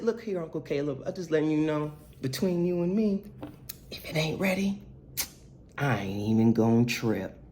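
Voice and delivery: soft, confiding aside; confidential nephew. Retold or imitated. Nephew confides between you and me.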